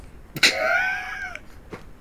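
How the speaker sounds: sudden high shrieking laugh; burst of laughter. Real, not acted. Streamer laughing at sacrificing Chloe, shrieking laugh.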